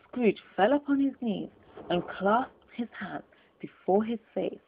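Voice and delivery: plain reading voice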